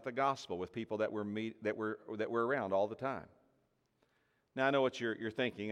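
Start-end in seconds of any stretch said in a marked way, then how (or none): none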